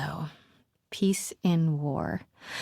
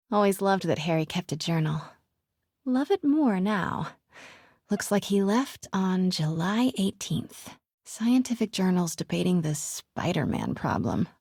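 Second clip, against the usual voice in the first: normal talking voice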